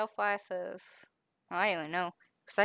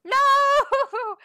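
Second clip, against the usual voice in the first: high, loud alarmed cry; man down shout; 11 dB louder than usual. Real, not acted. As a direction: shriek no in mock alarm. Friend hit her eye, calls out.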